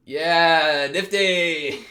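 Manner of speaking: loud cheering shout of the name; excited cheer. Real, not acted. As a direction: whoop for a favourite character. Cheering on a character.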